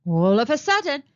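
ordinary delivery

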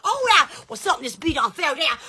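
usual speaking voice

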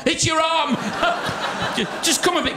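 high and bursting into laughter; can't get the words out for laughing; cracking up laughing. Real, not acted. Magician laughing at his own joke about Simon.

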